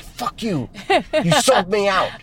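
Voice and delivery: high, put-on voice of a betrayed dog; angry dog voice. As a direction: voice the snitched-on dog, high and indignant.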